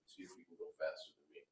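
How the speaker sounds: ordinary delivery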